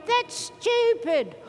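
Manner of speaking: high, drawn-out mocking little voice; slow, high inner critic. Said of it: "any little voice going".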